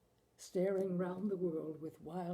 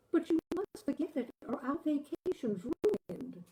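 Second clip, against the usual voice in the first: higher, faster wife soothing her husband; a raised, coaxing wife's voice; 6 semitones higher, 4 dB louder than usual. Acted. Wife urging husband to forget Picasso, higher and coaxing.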